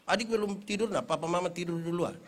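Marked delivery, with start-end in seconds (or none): none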